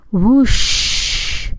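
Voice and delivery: breathy rushing gust of wind; blowing whoosh. A narrator's reading. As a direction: blow it out like a huge gust. Wolf blows the house.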